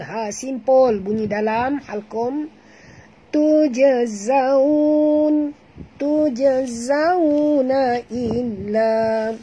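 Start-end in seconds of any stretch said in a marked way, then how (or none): 3.3-9.3 Quran recitation. Shown as a demonstration. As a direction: recite slowly and drawn out. Recites verse for class. (bacaan tartil perlahan)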